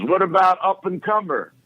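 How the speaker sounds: high, shouted heckle from the back; mock yell. Cosby heckling the announcer from the back, high mock yell. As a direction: yell it up at the stage in mock protest. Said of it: "he's yelling this in the audience".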